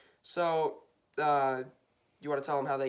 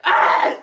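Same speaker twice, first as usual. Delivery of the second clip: sneeze; 15 dB louder than usual. Real, not acted. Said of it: "That's the sneeze of Bean".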